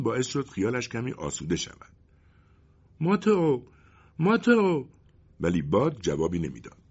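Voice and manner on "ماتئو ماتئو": high, loud call into the wind, rising on the second call. Acted. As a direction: call desperately for Mateo across the storm. Boy calling the lost wind.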